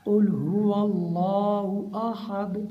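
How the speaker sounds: slow, measured chant with full long vowels; unhurried recitation. Shown as a demonstration. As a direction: recite the verse carefully, taking your time. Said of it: "इत्मीनान से पढ़ना है". (इत्मीनान से तिलावत)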